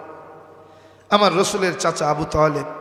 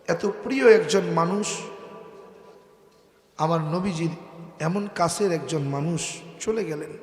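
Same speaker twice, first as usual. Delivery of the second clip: ordinary delivery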